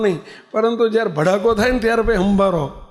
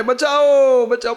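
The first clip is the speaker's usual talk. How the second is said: higher, drawn-out mock call for help; retold cry for help; 4 semitones higher, slower than usual. Man hanging from a root in the ravine, imitated cry for help. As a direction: call out like someone stranded, half-acted. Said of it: "મૂળિયું પકડીને બૂમો પાડે".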